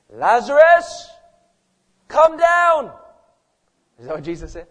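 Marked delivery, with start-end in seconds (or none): 0.1-2.9 loud, high, drawn-out summons; commanding call. Acted. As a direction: call it out slowly to someone far away. Voicing Jesus calling into tomb.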